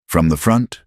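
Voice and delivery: ordinary delivery, nothing marked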